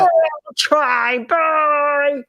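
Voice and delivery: high, playful sign-off; departing party reporter. Pretend. Reporter leaves the host for the party, playful high-pitched farewell. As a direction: call goodbye back to the studio.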